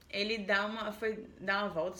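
normal talking voice

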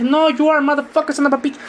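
high-pitched trash talk in English; mock gamer insult. Kid gamer insulting a teammate over the mic, high mock taunt. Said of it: "lo empecé a insultar".

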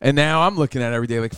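normal talking voice

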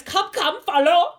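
loud, high command in hammed-up German accent; bad German accent. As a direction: call them on in a mock German accent. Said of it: "the German is disgusting".

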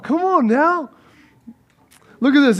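normal talking voice

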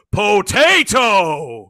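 loud, drawn-out mock shout of Potato; shouted punchline. Joke teller shouts the punchline for his friends, loud and slow. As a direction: blurt it out long and loud. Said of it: "and the Polish guy goes".

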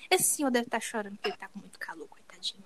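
unvoiced whisper, low and hushed; a hushed inner aside; whispered thought. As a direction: breathe the thought out as a secret.

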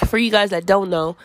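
ordinary delivery

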